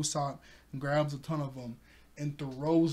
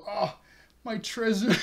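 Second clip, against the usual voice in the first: mock dismay; 6 semitones higher, 4 dB louder than usual. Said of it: "she goes".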